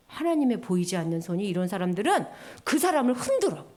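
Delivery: ordinary talk, nothing marked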